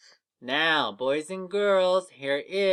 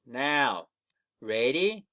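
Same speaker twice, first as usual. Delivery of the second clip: usual speaking voice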